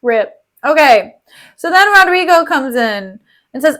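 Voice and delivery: usual speaking voice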